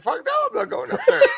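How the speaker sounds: high, laughing through the refusal. Retold or imitated. Worker refusing the officer, laughing.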